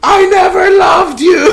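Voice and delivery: high, drawn-out mock dramatic scream; shouting out window. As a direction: holler it slowly out a car window. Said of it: "He's just shouting out the window".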